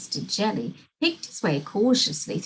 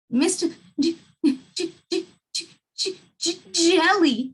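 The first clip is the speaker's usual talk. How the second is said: high, halting, stuck on the J; frightened stammer; 7 semitones higher than usual. A narrator's reading. Mister Jelly, too scared to say his name, high stammer. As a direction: stutter the name out in fear. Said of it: "stammered Mister Jelly".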